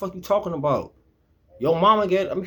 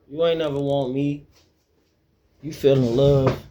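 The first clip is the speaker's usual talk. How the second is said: ordinary talk, nothing marked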